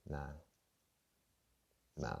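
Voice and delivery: usual speaking voice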